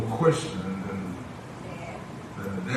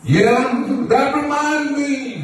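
projected sermon cadence in short, held phrases; preaching; 14 semitones higher, 9 dB louder, much slower than usual. Acted. Preacher assures the church that the Lord always provides, preaching. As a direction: stretch key words, then pause for the congregation. Preacher answers congregation's yeses.

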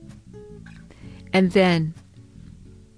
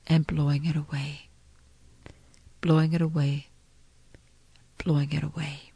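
fading to a whisper; 3 semitones lower, 6 dB quieter, faster than usual. Acted. Fading repeated guidance.

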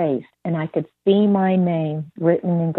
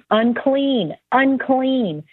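higher-pitched retold street cry; mild mock shout; 7 semitones higher, much slower than usual. Outcast woman warning passers-by, mock shout. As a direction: call it out high, without full volume. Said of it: "she had to shout out loud".

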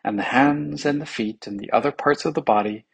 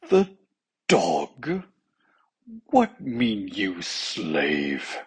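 incredulous start sinking to slow menace; in a rage; 3 semitones lower, much slower than usual. A narrator's reading. King confronts his officer, enraged. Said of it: "cried the king in a rage".

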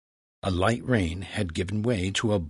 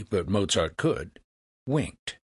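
ordinary delivery, nothing marked

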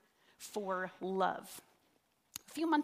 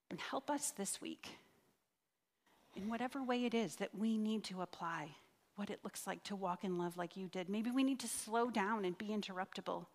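prayer; 2 semitones lower, 5 dB quieter than usual. Acted. Closing prayer.

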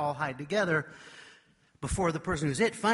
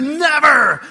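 a huge, high, loud scream; boy screaming refusal at the kneeling son; 11 semitones higher, 20 dB louder than usual. Retold or imitated. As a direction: scream it with all your force. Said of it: "And I screamed".

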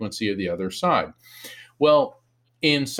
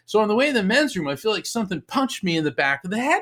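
usual speaking voice

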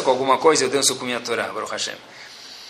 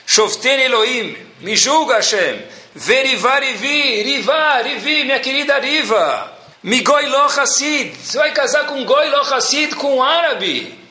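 higher, slow, wondering recital of the verse; emotional discovery; 7 semitones higher, slower than usual. Acted. Girl realising the psalm names her, higher and slower. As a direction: read each word as if it speaks to you.

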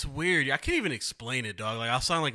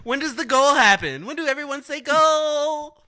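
mock clueless fan; 11 dB louder than usual. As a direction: whine it like she doesn't get football. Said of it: "She's like".

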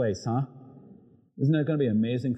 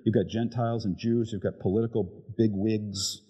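ordinary talk, nothing marked

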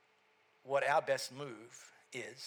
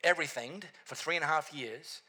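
loud, high-pitched pulpit preaching; full-force preaching; 5 semitones higher, 7 dB louder than usual. Acted. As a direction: preach it out to the whole room with fire.

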